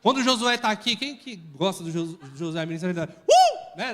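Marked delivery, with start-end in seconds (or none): 2.9-3.8 sudden high whooping uh; praise whoop. Shown as a demonstration. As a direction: let out a joyful whoop. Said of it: "Dá um uh!".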